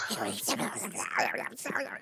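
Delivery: garbled, wordless duck-voice squawking; Donald Duck. Acted. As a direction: imitate a cartoon duck with no real words. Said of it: "I could do Donald Duck".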